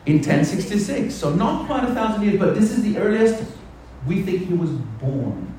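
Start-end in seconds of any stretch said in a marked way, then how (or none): none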